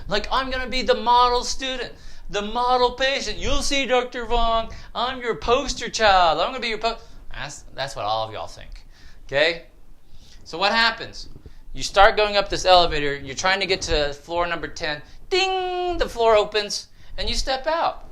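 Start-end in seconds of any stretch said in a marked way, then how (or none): 0.1-7.0 high, gushing mock excitement; hammed-up giddy new patient; excited patient. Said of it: "you're all excited". 15.3-16.0 high, ringing imitated bell; imitated high elevator chime; elevator ding.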